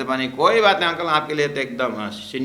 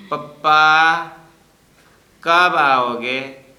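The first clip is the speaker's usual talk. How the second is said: put-on child voice; 4 semitones higher, 10 dB louder, much slower than usual. Grandson on phone begging grandpa.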